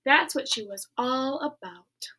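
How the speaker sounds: ordinary delivery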